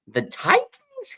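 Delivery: the word Titans drawn out way up high and slow; mock disbelief. Real, not acted. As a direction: scoff at an easy opponent. Mocking weak opponents on schedule.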